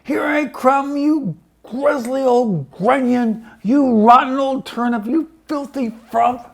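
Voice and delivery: high, loud witchy shriek; furious high screech of an old hag; screaming at the top of her voice. A narrator's reading. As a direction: shriek down at him from the sky. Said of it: "at the top of her voice".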